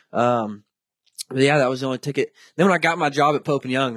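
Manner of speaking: ordinary talk, nothing marked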